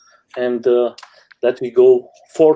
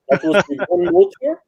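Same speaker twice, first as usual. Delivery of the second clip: laughs mid-sentence, voice jumping high; breaking into a laugh while telling it; 11 semitones higher than usual. Real, not acted. Laughing while recalling first board.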